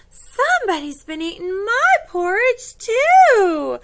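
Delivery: high, loud mother bear voice; Mama Bear. Acted. Finding her porridge eaten too, high dismayed mother bear. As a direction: lift it high in storybook dismay. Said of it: "Mama goes to hers and says".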